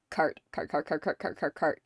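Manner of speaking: low, quiet rapid-fire repetition; hurried low stammer of self-correction; flustered repeating. Acted. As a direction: rattle it off under the voice, covering a slip.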